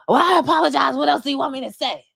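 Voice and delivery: high, fast exasperated yell; mock shout. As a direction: fire it back as an exasperated Kyle. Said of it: "Kyle is like".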